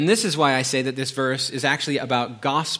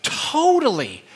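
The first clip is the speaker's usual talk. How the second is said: put-on high, drawn-out teen "Totally"; son's voice; 11 semitones higher, 4 dB louder, slower than usual. Son agreeing with dad, high drawn-out teen voice. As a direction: stretch it like a kid.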